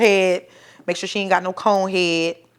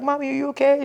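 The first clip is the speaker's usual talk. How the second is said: put-on young daughter asking her mother if she's okay; worried child voice; 7 semitones higher, faster than usual. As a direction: voice the small daughter checking in.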